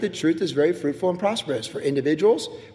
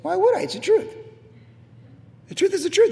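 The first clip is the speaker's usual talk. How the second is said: son's calm reply; 11 semitones higher than usual. Retold or imitated. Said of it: "And he goes".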